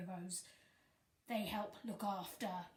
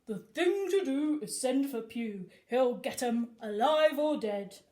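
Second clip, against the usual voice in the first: a hint of a bold police sergeant, louder; lightly voiced sergeant; 2 semitones higher, 8 dB louder than usual. Sergeant sending for Officer Pugh, mildly gruff. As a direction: call for Pugh with a sergeant's bluster. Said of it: "the station sergeant said".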